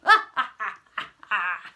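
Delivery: loud crocodile cackle; villain's loud laugh. Pretend. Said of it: "Can I hear your laughs".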